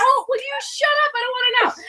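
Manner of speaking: high-pitched mock exasperation; imitated annoyed husband. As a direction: mimic a pestered husband's whiny protest. Acting out husband's annoyed reply.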